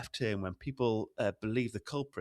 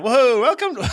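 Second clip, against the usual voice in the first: much higher, much louder showman opening; showman intro; 13 semitones higher, 14 dB louder than usual. Shown as a demonstration. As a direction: boom it out like a hammed-up radio host. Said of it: "I said whoa to try and do".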